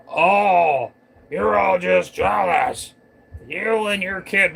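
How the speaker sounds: loud, high, drawn-out taunt; mock jeering. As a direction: throw it out as a mocking shout. Mimicking an accuser's jeer.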